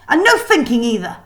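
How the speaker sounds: put-on teacher snapping at the girl; cutting off. A narrator's reading. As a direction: cut her off sharply. Kraken cutting her off.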